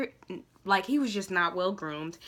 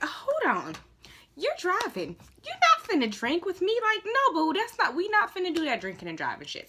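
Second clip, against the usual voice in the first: much higher, incredulous scolding; sassy refusal; 10 semitones higher than usual. Retold or imitated. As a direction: shut him down with sharp disbelief. Retelling refusing drunk driving date.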